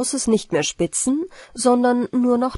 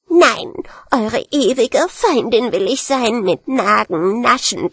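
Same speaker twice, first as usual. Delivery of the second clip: wütende Ratte; 3 semitones higher than usual. Acted. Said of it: "rief sie". (wütender Racheschwur der Ratte)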